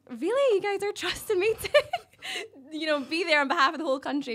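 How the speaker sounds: high-pitched laughing disbelief; can't believe they picked you; incredulous. Retold or imitated. Pageant winner questions being trusted with the country, incredulous.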